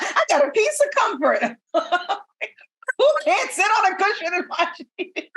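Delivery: laughing mock. Mocking comfort seeker, laughing.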